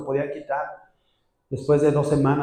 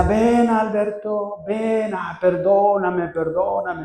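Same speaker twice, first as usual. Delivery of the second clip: higher, slow pleading voice; a hint of the wife begging him back; 6 semitones higher, much slower than usual. Wife calling her husband back to bed, lightly pleading. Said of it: "y me decía".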